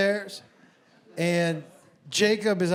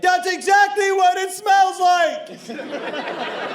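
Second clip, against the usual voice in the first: high, drawn-out indignant yell; arguing woman; 10 semitones higher, 4 dB louder, much slower than usual. Retold or imitated. Woman arguing with a man at the airport, yelling. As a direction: act out her loud, high-pitched protest.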